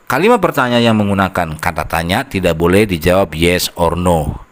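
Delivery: ordinary talk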